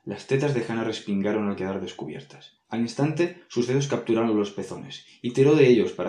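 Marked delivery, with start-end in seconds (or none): none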